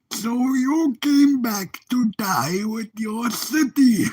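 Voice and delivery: slow, drawn-out villain impression; second stab at a grand supervillain voice; Bane. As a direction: stretch each word like a film villain. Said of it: "I mean, I'm trying here".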